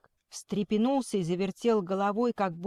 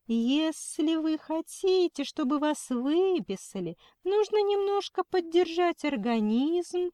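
kindergarten teacher voice; 7 semitones higher, 3 dB louder than usual. Acted. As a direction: talk down sweetly like to a child. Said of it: "она засюсюкала".

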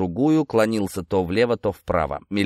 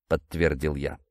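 ordinary delivery

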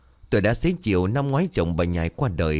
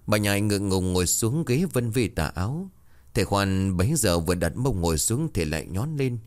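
ordinary delivery, nothing marked

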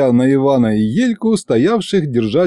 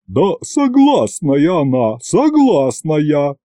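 slow, drawn-out booming matron voice; lightly voiced booming woman; 4 semitones higher, slower than usual. A narrator's reading. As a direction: stretch the words out heavily. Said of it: "пробасила Агриппина Падловна".

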